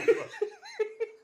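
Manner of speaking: squealing cackle; high wheezing laugh. Real, not acted. Reacting to crude joke.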